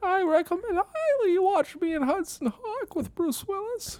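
very high mock Danny Aiello; Danny Aiello impression. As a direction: squeak out a sales pitch as Danny Aiello. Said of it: "You sound like".

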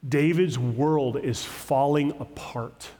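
ordinary talk

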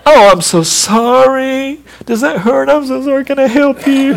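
a mother cooing over a scraped knee; comforting mama. Retold or imitated. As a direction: soothe a hurt child tenderly. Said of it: "And what does Mama do?".

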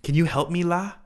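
casual Singlish "la?" ending; Singapore la. Shown as a demonstration. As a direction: end on a light, rising "la?". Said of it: "it says, like".

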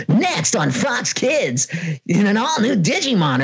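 slow, high, booming promo read; network promo announcer. Retold or imitated. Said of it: "the voice of the network".

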